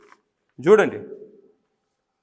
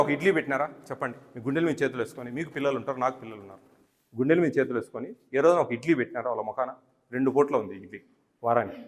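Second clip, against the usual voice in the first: quiet appeal; 7 semitones lower, 11 dB quieter than usual. Real, not acted. Official asking the warden, parent to parent, quietly and earnestly. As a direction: lower and much softer, hand on heart.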